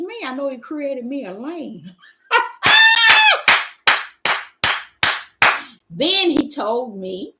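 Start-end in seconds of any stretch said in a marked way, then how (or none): 2.3-5.7 long, loud, high laugh. Real, not acted. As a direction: let out a real shrieking laugh. Laughs after her own remark.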